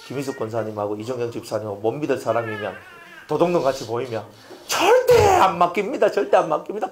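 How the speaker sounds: chuckling through the words; half-laughing, half-teasing. Real, not acted. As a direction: laugh while teasing the congregation. Joking about trusted deacons.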